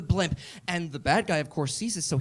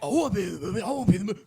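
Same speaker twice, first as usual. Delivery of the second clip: whiny Statham voice; 4 semitones higher, much faster than usual. Retold or imitated.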